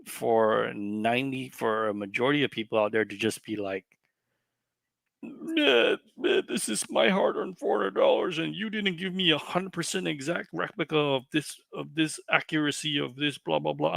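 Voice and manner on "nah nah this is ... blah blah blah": much higher, sneering mimicry; mocking buyers who demand exact replicas; mock entitled buyer. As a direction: parody a spoiled collector.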